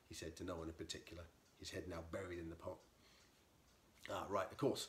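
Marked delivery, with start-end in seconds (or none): 4.1-4.9 flustered, louder, tripping over the words; spluttered. Acted. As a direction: splutter it, caught out. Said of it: "the intruder spluttered".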